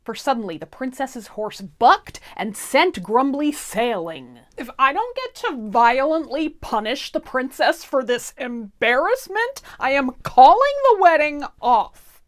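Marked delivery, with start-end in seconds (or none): 4.6-12.2 louder and higher, a petulant threat; Grumbly's tantrum. Acted. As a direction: demand revenge like a spoiled duchess. Grumbly demands punishment, threatens.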